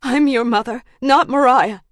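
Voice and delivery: high, loud insistence; urgent confession. Acted. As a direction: force the truth out urgently. Urgent confession to her daughter.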